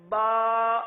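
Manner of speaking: با loud and held out; model ba. Shown as a demonstration. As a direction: recite the correct با firmly. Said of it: "یہ ادا کرنا ہے". (صحیح با کی ادائیگی)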